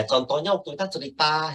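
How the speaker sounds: ordinary talk, nothing marked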